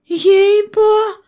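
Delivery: very high squealed oath; high, indignant squeal of innocence; protesting peasant woman. A narrator's reading. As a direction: yelp a half-finished oath. Woman swears, cut off.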